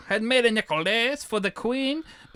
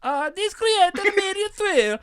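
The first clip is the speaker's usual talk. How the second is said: much higher, hammed-up Italian narrator; Italian accent; 11 semitones higher, 3 dB louder than usual. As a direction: ham it up like a stage Italian, laughing through it. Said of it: "I don't know if I can do an Italian accent".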